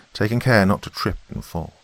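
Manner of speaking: usual speaking voice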